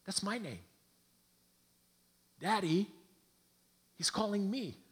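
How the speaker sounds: hint of a child telling his dad; piccolo figlio. Small son speaking to father.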